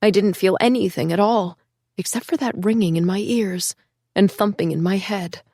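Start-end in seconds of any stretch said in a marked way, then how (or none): none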